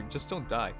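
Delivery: ordinary talk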